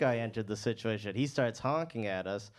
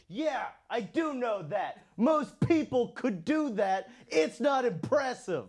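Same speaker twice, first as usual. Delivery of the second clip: squeaky angry comeback; 11 semitones higher, slower than usual. Retold or imitated. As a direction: squeak it out, fake-defiant and feeble. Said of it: "but I am angry".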